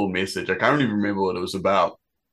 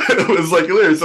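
higher and louder, talking through laughter; laughing; 10 semitones higher, 9 dB louder, faster than usual. Real, not acted. Telling friends about the troll's apology, still laughing. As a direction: laugh the words out.

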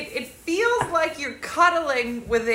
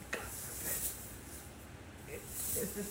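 much softer, very slow breathy strain; strained whisper; 3 semitones lower, 19 dB quieter, much slower than usual. Real, not acted. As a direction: whisper it while lifting something heavy. Straining to lift heavy blanket.

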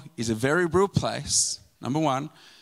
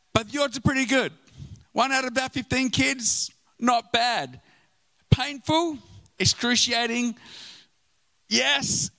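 higher, breezy mock indifference; mock flippant; 7 semitones higher than usual. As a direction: shrug off the tragedy lightly. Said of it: "I'm like".

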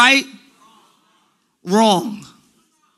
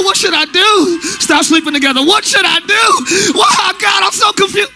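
mock wailing; 8 semitones higher, 5 dB louder than usual. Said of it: "I'm doing exaggeration".

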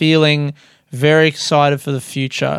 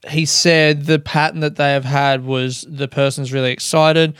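normal talking voice

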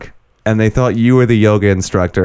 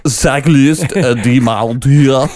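slow, stretched-out fake French accent, pitched up; mock French accent; 5 semitones higher, much slower than usual. Friend asks for the sign in a French accent, hammed-up French accent. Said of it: "Just say it in a French accent".

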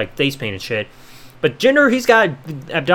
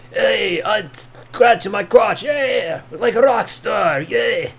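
slow, drawled mock rocker accent of no real origin, crooning yeah; mystery rock star accent; 3 semitones higher, 3 dB quieter, much slower than usual. Mocking a wrestler in a slow, vague rock star accent. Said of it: "I don't know what accent I'm doing there".

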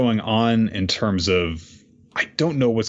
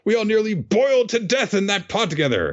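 betrayed comrades; 12 semitones higher than usual. Retold or imitated. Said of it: "So everyone's like".

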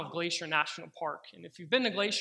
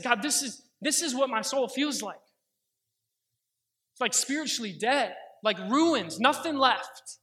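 yelling at God; 4 semitones higher, 4 dB louder than usual. Retold or imitated. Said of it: "I began yelling at the Lord".

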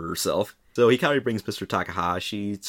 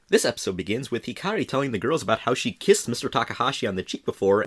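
ordinary delivery

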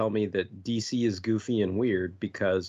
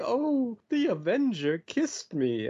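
fluttered swooning; 12 semitones higher, slower than usual. Retold or imitated. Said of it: "gets all a fluttered and has tears and is like".

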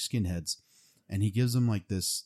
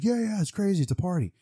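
high, loud imitation of a hyped-up singer; mock party hype; 12 semitones higher, 5 dB louder than usual. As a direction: whoop it up like a rowdy frontman. Imitating singer shrugging off chaos.

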